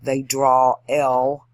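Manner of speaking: drawn-out, emphatic drawl; slow Southern drawl. Shown as a demonstration. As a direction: drag out draw and drawl, loudly. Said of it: "they talk slowly".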